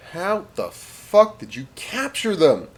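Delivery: high, slow, drawn-out incredulity; baffled disbelief. Acted. Commanding officer to Jones over his captives, high baffled disbelief. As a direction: stretch the question out in high-pitched amazement.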